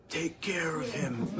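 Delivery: a hint of a mob boss giving orders; mafia voice. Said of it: "if we go with the, uh, mafia voice".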